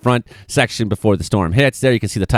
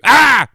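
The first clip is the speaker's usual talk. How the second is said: mock scream; 14 semitones higher, 6 dB louder than usual. Mock scream at harmless map.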